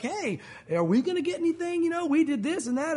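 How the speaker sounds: lightly voiced eager disciple; mildly pleading for a prize; eager Peter. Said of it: "He's like".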